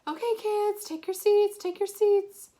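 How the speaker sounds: put-on high, loud call to a roomful of kids; teacher calling out. A narrator's reading. Teacher summoning fifth graders to their seats, calling out loud and high. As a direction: raise the voice over a noisy room.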